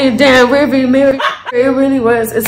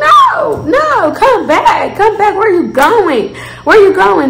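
far higher, mocking come back; taunting shout; 6 semitones higher, slower than usual. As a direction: yell after him, daring him to return. Taunting shout after fleeing man.

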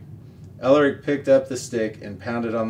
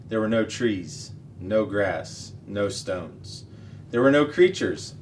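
ordinary delivery

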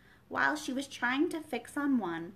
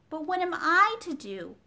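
pleading; 7 semitones higher, 6 dB louder than usual. A narrator's reading. Alice pleading.

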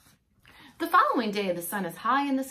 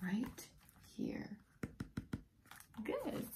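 whispering; 15 dB quieter, much slower than usual. Real, not acted. Pointing to the hidden frog for the listener, whispered. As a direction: let the secret out in a whisper.

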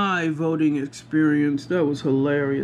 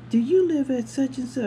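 put-on higher, polite young clerk voice; polite poll worker; 8 semitones higher than usual. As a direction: ask sweetly like a helpful clerk.

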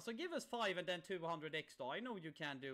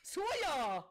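shrieking jackpot winner; 8 dB louder than usual. Real, not acted. Hits the max win.

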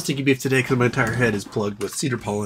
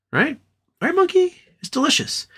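much higher squeaky voice to the dog; pet talk. Real, not acted. To the dog Monkey about ramen, high pet talk.

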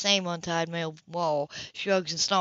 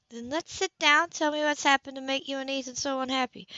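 coaxing friend; 7 semitones higher than usual. Acted. Anna coaxes Grace, higher.

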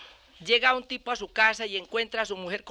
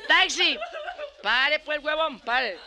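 put-on drunk hailing a taxi; 4 semitones higher, much slower than usual. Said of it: "Como el borracho que sale".

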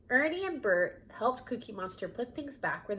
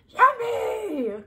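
high, loud monster squeal; excited cry; 13 semitones higher, 9 dB louder than usual. Acted. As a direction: cry out in delight. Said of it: "cried Cookie Monster".

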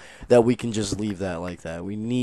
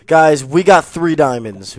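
loud, higher, stretched-out words; triumphant announcement; 5 semitones higher, 10 dB louder than usual. Real, not acted. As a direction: proclaim the win to your viewers. Announces diamond find.

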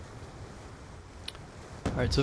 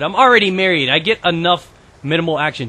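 normal talking voice

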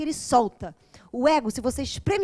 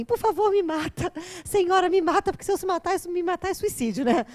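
higher, exaggerated pleading for mercy; mock despair; 5 semitones higher than usual. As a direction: beg to be put out of your misery, hammed up. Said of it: "quase que eu peguei uma arma e falei assim". (súplica exagerada e aguda, de brincadeira)

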